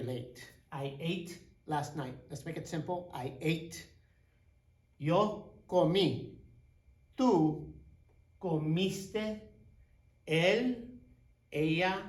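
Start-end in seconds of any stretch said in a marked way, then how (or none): 5.0-12.0 slow clear drill. Shown as a demonstration. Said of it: "Here's an accent here on the O".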